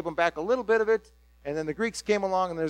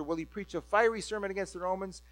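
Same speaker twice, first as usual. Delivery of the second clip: usual speaking voice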